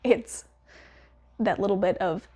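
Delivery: ordinary talk, nothing marked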